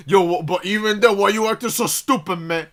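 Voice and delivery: retold father arguing at full volume; imitated yelling dad. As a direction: play the dad demanding an explanation. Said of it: "like, a yelling match at each other".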